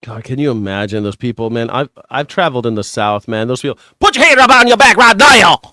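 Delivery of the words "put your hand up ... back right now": loud, high barking Southern cop. Retold or imitated. Streamer imitating a Southern cop yelling at a suspect. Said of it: "God, that voice".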